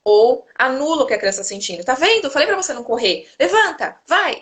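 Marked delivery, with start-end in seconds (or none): 1.8-4.4 higher, louder mock reprimand; scolding. Parent telling off a fallen child, mock impatient scolding.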